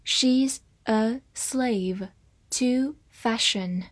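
very slow, careful reading of "She's a slave to fashion"; slowed-down model sentence. Shown as a demonstration. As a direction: draw it out slowly. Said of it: "我们慢慢来读".

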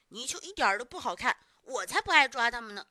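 loud sour-grapes dismissal; embarrassed bluster. A narrator's reading. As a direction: brush it off loudly to save face. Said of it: "小牛羞得像个红脸的大公鸡".

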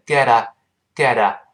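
"get a" run together as "gedda"; linked get a. Shown as a demonstration. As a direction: turn the t in "get a" into a light d. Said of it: "连读变成get a".

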